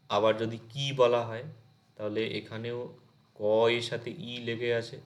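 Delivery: normal talking voice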